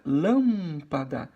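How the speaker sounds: "lâmpada" with a long, loud LÂM; stress on LÂM. Shown as a demonstration. Teacher showing pupils the right stress, loud stretched LÂM in "lâmpada". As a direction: hold and punch the first syllable of "lâmpada". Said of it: "é a penúltima. Então fica".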